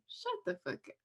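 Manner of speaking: whispered mock put-down; mock shushing. Someone silencing her for doing an accent, whispered.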